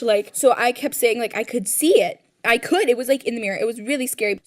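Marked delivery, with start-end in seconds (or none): none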